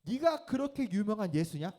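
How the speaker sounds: loud, high jeering taunt at Jesus; mocking robber. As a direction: sneer it up at the man on the cross. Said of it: "이 두 강도는 ... 이렇게 얘기한 거야". (십자가의 예수를 조롱하는 강도, 높고 큰 비웃음)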